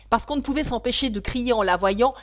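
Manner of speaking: loud, vexed retort building to a cry; angry. A narrator's reading. Mock Turtle explains the nickname to Alice, angry and loud. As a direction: raise the voice in annoyance, crying out the last words. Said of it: "dit la fausse tortue d'un ton fâché".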